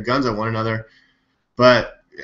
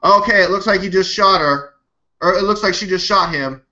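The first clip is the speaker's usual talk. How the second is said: mocking the commenter; 7 semitones higher than usual. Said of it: "someone who's commenting ... video goes".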